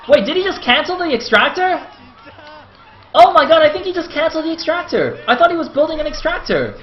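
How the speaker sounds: high, loud and incredulous; real stunned high-pitched exclamation; astonished disbelief. Real, not acted. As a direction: blurt it out in shocked confusion. Caster stunned by sudden elimination.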